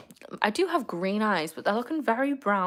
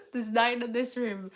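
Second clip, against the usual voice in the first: laughing. Real, not acted. Laughing while recounting plants.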